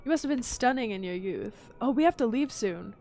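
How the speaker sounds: ordinary talk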